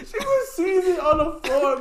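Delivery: high, loud laughter breaking through the words; cracking up while telling it; real laughing fit mid-story; laughing hard. Real, not acted.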